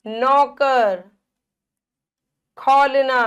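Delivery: नौकर, खोलना slowly with wide औ; careful sounded-out नौकर, खोलना; open औ vowel. Shown as a demonstration. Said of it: "औ की मात्रा आ रही है".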